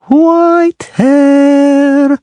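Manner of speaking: high ringing call across the water; mermaid calling out. A narrator's reading. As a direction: sing out the name like a mermaid. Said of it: "the mermaid called".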